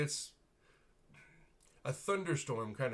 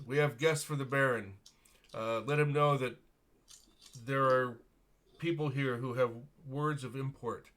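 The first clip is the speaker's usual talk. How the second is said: firm captain; 2 semitones higher, 7 dB louder than usual. Acted. Captain announces guests firmly.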